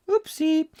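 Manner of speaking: high squeaked little oops; light comic high-pitched slip-up; mock-sheepish oopsie. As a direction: pipe it up high, playfully guilty. Mock apology for waking baby.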